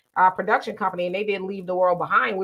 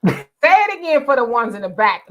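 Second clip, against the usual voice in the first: louder, raised-pitch shout-out; playful call-out; 4 semitones higher, 7 dB louder than usual. Pretend. Host teasing a guest's plug, playfully calling out. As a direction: project it jokingly to the back rows. Jokingly calls out, then just kidding.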